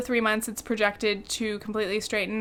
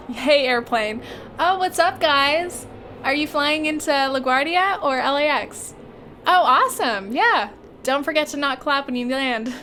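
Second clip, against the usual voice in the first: excited calling out; 4 semitones higher, 4 dB louder than usual. Acted. Calling out to a passing airplane.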